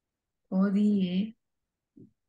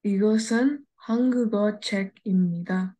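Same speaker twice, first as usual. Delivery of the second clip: whole sentence read through a little faster; learner's more confident full-sentence reading; louder, more fluent; faster than usual. Shown as a demonstration. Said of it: "say it loudly, fluently, fastly".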